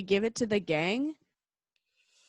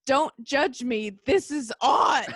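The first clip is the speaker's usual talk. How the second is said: put-on slow, louder Philly accent, lightly done; heavy Philly accent; 3 semitones higher, 6 dB louder, slower than usual. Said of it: "In his heavy Philly accent".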